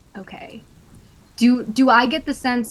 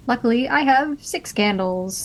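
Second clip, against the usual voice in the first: usual speaking voice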